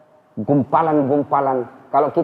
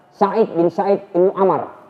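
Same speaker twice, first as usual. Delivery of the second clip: ordinary talk, nothing marked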